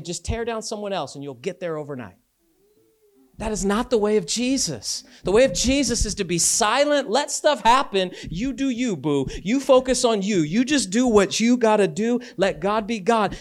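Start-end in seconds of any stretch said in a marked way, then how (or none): 3.4-13.3 forceful preaching. Real, not acted.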